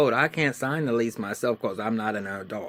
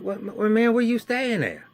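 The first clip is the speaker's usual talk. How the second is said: much higher, louder excited brother; excited greeting; 13 semitones higher, 7 dB louder than usual. Retold or imitated. Brother greeting his little brother, higher, louder and excited. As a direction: burst out happy to see him.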